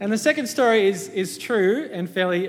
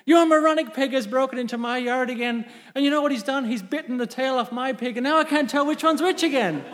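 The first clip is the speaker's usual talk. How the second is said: high-pitched comic farmer's tirade; mock outrage; 6 semitones higher than usual. Farmer complains to neighbour about his pig, comically outraged. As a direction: rant at the neighbour, hammed up.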